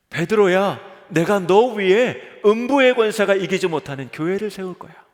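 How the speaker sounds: loud, fond call of "베드로야"; Jesus calling Peter. Acted. Jesus calling out to Peter, loud and warm. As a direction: call out to Peter loudly and warmly. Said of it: "예수님이 지금 이렇게 말씀하시는 거예요".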